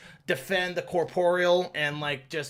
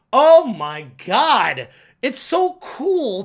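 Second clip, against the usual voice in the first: louder, slow, drawn-out awe; awed exclamation; 5 semitones higher, 8 dB louder, much slower than usual. Real, not acted. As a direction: savour how cool it was. Raving about visuals.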